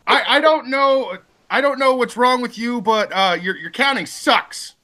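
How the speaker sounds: squeaky high mock whine. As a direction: go way up into a silly voice.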